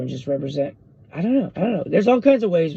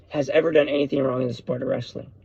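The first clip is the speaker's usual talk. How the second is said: normal talking voice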